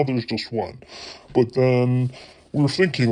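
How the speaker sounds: ordinary delivery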